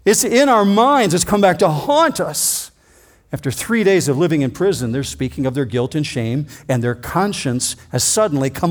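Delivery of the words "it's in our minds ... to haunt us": higher, louder, a hint of dread; lightly voiced haunted brothers, rising; haunted confession. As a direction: voice the brothers' lingering terror. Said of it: "they're saying".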